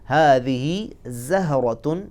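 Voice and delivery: हादिही ज़हरतुन slowly in an Arabic accent, rising. Shown as a demonstration. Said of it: "अरबी लहजे को मद्देनज़र रखना है".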